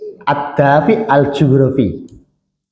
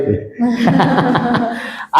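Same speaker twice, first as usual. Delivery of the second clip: burst of laughter; 7 semitones higher, 4 dB quieter than usual. Real, not acted. Laughs at his own joke.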